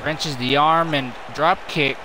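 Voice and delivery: brisk, running wrestling call; play-by-play commentary. Acted. Commentator calling the match for viewers, brisk play-by-play. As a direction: keep the action rolling like a ringside caller.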